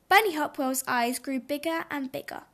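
plain reading voice

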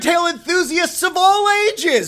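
loud, high showman announcing; ringmaster. Acted. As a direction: roll up the crowd like a circus barker. Host opening the show.

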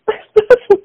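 high, loud burst of laughter. Real, not acted. Mother realizes the dinner cruise surprise, bursting laughing. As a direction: laugh out in relief.

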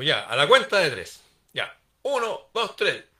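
loud, high count to three before a heavy lift. Acted. Bodhidharma to the king, counting to lift the table loud. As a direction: call out the count like rallying for a lift.

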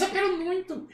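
very high puppet pleading; Kleber. Acted. Puppet begging the host to let him sing, high puppet voice. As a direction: insist as a squeaky dummy. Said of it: "acha uma voz que pareça com o boneco".

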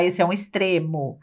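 usual speaking voice

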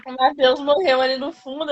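normal talking voice

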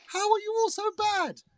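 high exasperated. Acted. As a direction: squeal it in disbelief. Psychic mocks singers.

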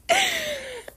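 very high squeal of a laugh; shocked laugh. Real, not acted. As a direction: squeal with disbelief. Shocked at thousand views.

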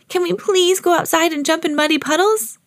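put-on high-pitched eager child; excited little pig wanting permission; excited asking. A narrator's reading. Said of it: "asked Peppa excitedly".

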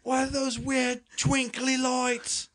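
very high, slow, prissy posh English; posh British accent. Pretend. Friends goofing, nervous posh Brit spotting lights, high posh accent. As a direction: play a frightened toff in the forest. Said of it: "There was a posh one in there".

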